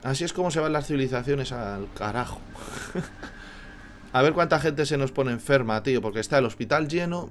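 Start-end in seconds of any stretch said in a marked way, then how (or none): 2.4-4.1 soft airy chuckle; breathy laugh. Real, not acted. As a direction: laugh quietly at the disaster. Laughs at doomed civilization. (risa ahogada)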